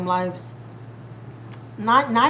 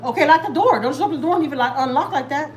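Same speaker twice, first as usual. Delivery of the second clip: raised, higher-pitched instruction; real parental order; 5 semitones higher, 4 dB louder than usual. Real, not acted. Mother telling her child to lock the door, raised-voice scolding. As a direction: call it out loud, easing off toward the end.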